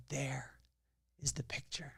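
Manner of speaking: hushed reveal. Retold or imitated. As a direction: let the reveal land quietly. Reinhold reveals the Picasso.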